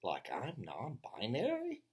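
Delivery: slow, drawn-out mock offense; mock indignant. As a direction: pretend to be deeply insulted. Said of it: "And I said".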